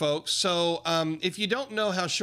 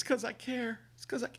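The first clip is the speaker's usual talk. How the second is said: higher, softer mock whimper; mock crying; 7 semitones higher, 6 dB quieter than usual. Vlogger mimicking a crying CEO on CNBC, mock whimper. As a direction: imitate a billionaire sniveling on TV. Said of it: "he was crying on C N B C".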